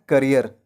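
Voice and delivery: "करियर" as kuh-ree-er, falling; correct pronunciation. Shown as a demonstration. Teacher answers own question, correct "kuh-ree-er". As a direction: say "करियर" with short uh first, tune falling. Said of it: "क्या बनाना है?".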